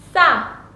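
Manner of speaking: tense, tough 싸. Shown as a demonstration. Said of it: "it sounds more tough".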